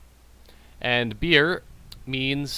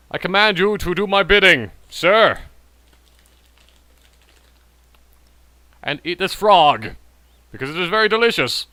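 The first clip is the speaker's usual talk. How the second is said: playfully imperious order; mock master's command; 4 semitones higher, 4 dB louder than usual. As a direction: command a servant, hamming it up.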